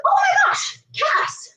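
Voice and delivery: high-pitched excited squeal of a name. Real, not acted. Hosts squealing as their friend Cass joins, excited. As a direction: squeal with delight at a friend arriving. Spotting Cass joining the live.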